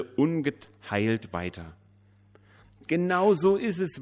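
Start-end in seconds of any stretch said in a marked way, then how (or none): 2.9-4.0 high, loud weeping agreement. A narrator's reading. Widow weeps in agreement with the pastor, high and loud. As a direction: cry it out, pitched high. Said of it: "weinte sie".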